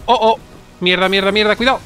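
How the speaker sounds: ordinary talk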